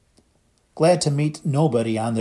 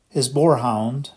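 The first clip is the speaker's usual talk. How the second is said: ordinary delivery, nothing marked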